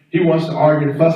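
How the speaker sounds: normal talking voice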